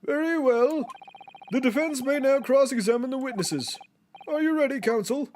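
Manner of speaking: lightly voiced stern judge.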